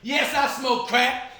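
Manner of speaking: high, loud, drawn-out proclamation; defiant declaration. Retold or imitated. Aunt confesses to teasing schoolkids, slow loud mock defiance. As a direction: announce it brazenly to the whole crowd. Aunt's acted speech to schoolkids.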